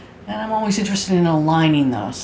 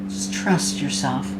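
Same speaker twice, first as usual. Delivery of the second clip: guided meditation. Acted. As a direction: guide them gently into body awareness, unhurried.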